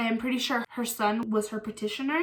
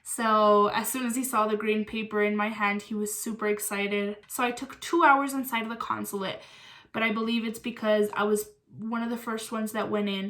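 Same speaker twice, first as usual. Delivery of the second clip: ordinary delivery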